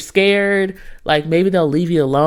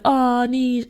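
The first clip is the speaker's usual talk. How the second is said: imagined pitying listeners; 6 semitones higher than usual. Retold or imitated. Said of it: "you guys are probably like".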